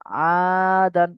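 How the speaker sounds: আদান held long and slow, each vowel stretched; drawn-out recitation. Shown as a demonstration. Teacher showing students how to pronounce আদান, slowly chanted. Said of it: "আমি যেমন বলছি শুনুন".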